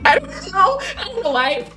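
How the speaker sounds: real laughter breaking through the words; laughing too hard to finish; cracking up. Real, not acted. Reviewer cracking up at a funny scene, laughing. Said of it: "I was cracking up".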